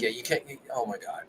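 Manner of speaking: normal talking voice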